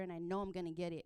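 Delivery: normal talking voice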